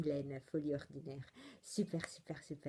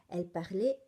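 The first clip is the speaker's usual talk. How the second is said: ordinary talk, nothing marked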